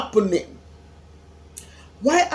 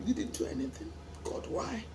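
whispered, grief-struck plea; whispering to God in despair; broken whisper; 13 dB quieter than usual. Real, not acted. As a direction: breathe it out, spent from grief.

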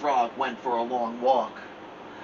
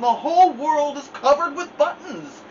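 much higher, louder angry scream, slowing at end; 14 semitones higher, 6 dB louder than usual. Acted. Angry Toad screaming, much higher and louder. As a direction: shout it at the whole world.

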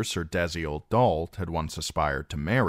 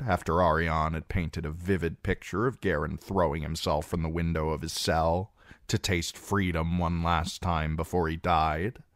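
ordinary delivery

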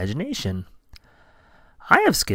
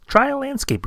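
high bird voice; 13 semitones higher than usual. Acted. Bird suggests.

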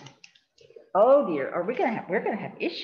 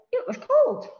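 high-pitched cold; 5 dB louder than usual. Real, not acted. Recalling the cold day.

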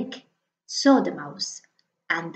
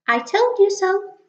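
much higher mouse, bright and quick; little mouse voice; 11 semitones higher, 4 dB louder than usual. Acted. The mouse announcing Snake, high little voice. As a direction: pitch it up like a tiny mouse.

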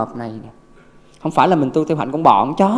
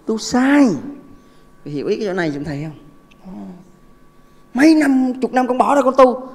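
impassioned preaching; 8 semitones higher, 3 dB louder than usual. Real, not acted. Preaching about wasted years of practice.

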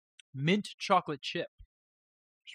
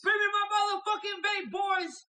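sudden yell, far above usual pitch; high-pitched shout. Pretend. Streamer yelling to the boys on stream, high-pitched shout. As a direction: holler it out in a very high voice.

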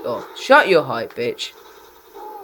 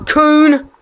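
rage-scream it; furious yell; 11 semitones higher, 9 dB louder than usual. Real, not acted. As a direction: spit the word out high and loud. Gamer raging at opponent.

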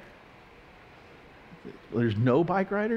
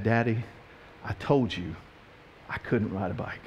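put-on low, soft, deflated child's line; acting a defeated little girl, quietly; subdued reproach; 6 semitones lower, 6 dB quieter than usual. Fallen daughter to daddy after hitting pole, subdued reproach.

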